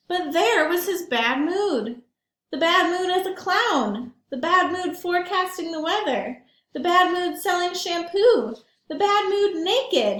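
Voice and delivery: put-on lively read-aloud for kids; storybook narration. A narrator's reading. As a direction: tell it to the children with relish. Reads picture book aloud.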